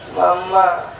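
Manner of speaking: drawn-out childish whine, lightly voiced; a kid tugging at mum, slow and pleading; mock child nagging, slow; whiny child. Child nagging mother for school.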